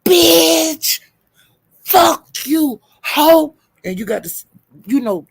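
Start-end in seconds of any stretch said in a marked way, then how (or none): 0.0-3.6 loud, high, drawn-out insults with pauses; demonstrated cuss-out. Shown as a demonstration. Coaching Lola for when she's pissed off, demonstrated cuss-out. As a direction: push each word out high and hard. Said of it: "breathe hard when you say it".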